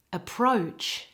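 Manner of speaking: "Approach" with all the weight on PROACH; teacher's careful "Approach", stressed at the end; stress on second syllable. Shown as a demonstration. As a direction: model "Approach", light first syllable, full gliding O in the second. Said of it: "all of the emphasis in this word is on the second syllable".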